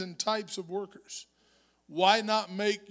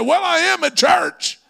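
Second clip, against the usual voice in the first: higher, louder proud answer; father telling the doctor he sings at church, raised voice; retold loud reply; 9 semitones higher, 12 dB louder than usual. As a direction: belt out the dad's answer. Dad answering doctor, story climax.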